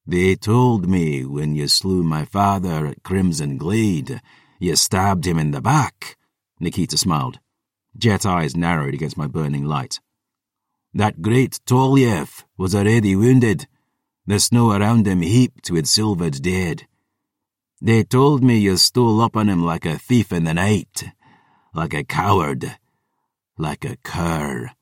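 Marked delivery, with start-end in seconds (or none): 0.1-6.2 put-on slow, accented warrior daughter; level, measured accusation in a character accent; vengeful Kiara, accusing. 10.9-24.7 put-on accented warrior daughter, mounting contempt; scornful accusation in a character accent; vengeful Kiara, accusing.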